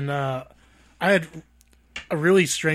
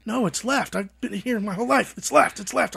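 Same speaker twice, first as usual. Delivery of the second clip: insistent mom; 7 semitones higher than usual. Retold or imitated. Mom insisting in dream story.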